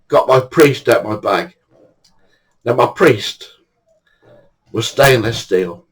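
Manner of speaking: usual speaking voice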